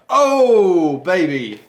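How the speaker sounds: high, loud "oh", then dropping; gleeful celebration. Real, not acted. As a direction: crow with delight over the reveal. Celebrating the best cow card.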